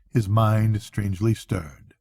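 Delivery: ordinary delivery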